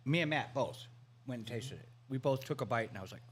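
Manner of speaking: ordinary talk, nothing marked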